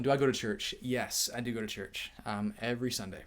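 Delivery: ordinary delivery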